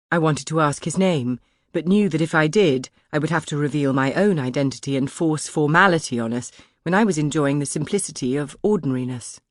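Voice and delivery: ordinary delivery, nothing marked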